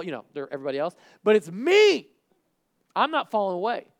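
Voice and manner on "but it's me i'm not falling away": loud, raised-pitch protest of loyalty; emphatic acted pledge; insistent vow. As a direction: insist on it with full conviction. Acted Peter insisting on his loyalty.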